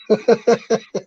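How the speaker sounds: high-pitched giggle; amused laugh. Real, not acted. Streamer amused by winging the picks, high laugh.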